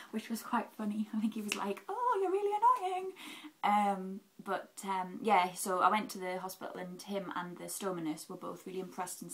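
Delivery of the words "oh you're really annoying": high, loud imitation of playful annoyance; mock exasperation. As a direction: imitate a teased surgeon's exasperated cry. Acts surgeon's reaction to her joke.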